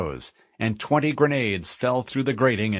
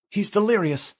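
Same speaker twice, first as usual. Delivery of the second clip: female AI voice; 11 semitones higher than usual. Acted. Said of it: "Cortana said".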